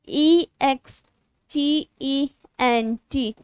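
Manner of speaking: slow, letter-by-letter trial spelling. Shown as a demonstration. Teacher slowly spelling a word for her students to check. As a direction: sound out each letter slowly. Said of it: "ये थोड़ा better लग रहा है".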